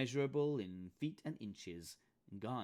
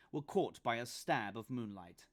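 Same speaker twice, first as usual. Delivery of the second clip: usual speaking voice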